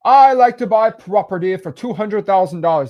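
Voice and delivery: a clueless tycoon proudly naming his price; snobby rich guy. Retold or imitated. Teacher voicing the rich buyer, pompous and high. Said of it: "And he's like".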